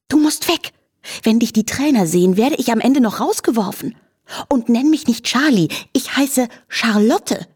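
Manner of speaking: high, huffy scolding; indignant snort. A narrator's reading. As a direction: snort it crossly, leaning on the name. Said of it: "schnaubte sie".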